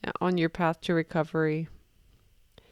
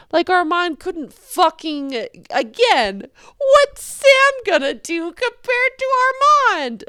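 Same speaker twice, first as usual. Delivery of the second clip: exasperated rant; 10 semitones higher, 6 dB louder than usual. Real, not acted. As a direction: pitch shoots way up, outraged disbelief. Ranting about the show.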